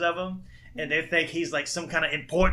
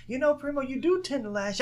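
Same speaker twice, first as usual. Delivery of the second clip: mock therapist; 10 semitones higher than usual. Acting the therapist.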